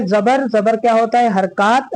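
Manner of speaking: ordinary delivery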